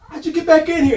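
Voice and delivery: can't believe he got back in; retelling mock astonishment; astonished. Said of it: "I was like".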